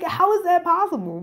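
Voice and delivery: high, incredulous question; can't believe what you're hearing; stunned disbelief. Retold or imitated. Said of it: "I was like".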